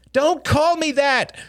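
very high, drawn-out whine; squeaky, stretched objection; indignant little boy. Acted. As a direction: protest like an offended child. Small boy protests hated nickname.

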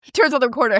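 ordinary talk

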